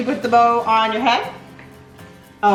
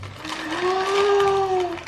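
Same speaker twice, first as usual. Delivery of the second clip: long gasp of discovery; drawn-out delighted intake; big surprised gasp; 3 semitones higher, 4 dB quieter than usual. Real, not acted. Opening child's present.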